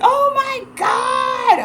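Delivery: very high, loud, slow drawn-out shout; shocked friend. Retold or imitated. Imitating her friend told to look it up, shocked drawn-out shout. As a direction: stretch the disbelief out in a shout. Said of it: "And she's like".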